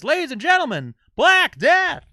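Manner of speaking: loud, high arena announcer call. Retold or imitated. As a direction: boom it out like a rock show emcee. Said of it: "the announcer goes".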